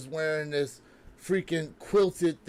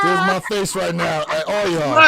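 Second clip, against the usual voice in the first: after laughing hard; 8 dB louder than usual. Real, not acted. Laughing taunt at the others.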